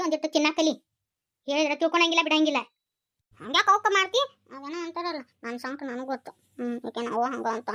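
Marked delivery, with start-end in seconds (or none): 4.6-7.7 softer, lower muttered aside; half to herself; quiet aside. Acted. Mother grumbling to herself about her pain, quiet aside.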